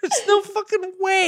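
high cracked laugh-speech; laughing disbelief. Real, not acted. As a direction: squeal through laughter.

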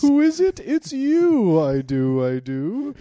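very high, loud carnival yell; barker shout. Acted. Groom shouting vows at the bride, barker style. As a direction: belt it to the whole fair.

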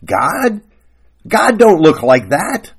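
high-pitched, disbelieving retort; incredulous wife. Acted. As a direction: echo it as a question, then loudly object. Wife scoffs at husband's answer.

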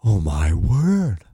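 awed passerby. Acted. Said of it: "a passerby is".

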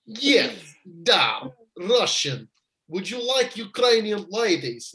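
put-on slow, deliberate Russian accent. Second go at Roman's offer, careful Russian accent. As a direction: set the Russian accent firmly, then pitch up. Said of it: "I nailed it that time".

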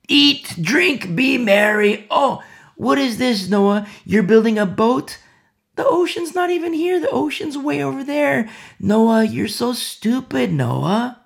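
high, loud jeering at a fool; mocking scoffer. Scoffer jeering at Noah building his boat, loud and high.

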